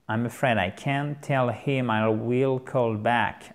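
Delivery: slow, word-by-word teaching delivery, "will" in full; slow model sentence. Shown as a demonstration. Teacher repeats the phone reply for learners, slowly. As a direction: spell out each word slowly, "I will" uncontracted.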